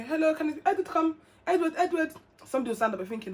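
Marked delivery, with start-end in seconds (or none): 0.0-2.1 nurse calling out. Retold or imitated. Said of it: "The nurse will say".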